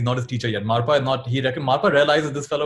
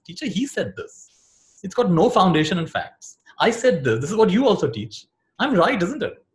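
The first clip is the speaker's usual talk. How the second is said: higher and louder, insisting he's right; worked-up student; 8 semitones higher, 4 dB louder than usual. Acted. Second student insists to the teacher he is right, heated. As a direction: push hard, almost indignant.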